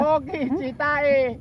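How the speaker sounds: slow, drawn-out high call. Real, not acted. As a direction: stretch the words out to the mountains. Shouting to hear the echo.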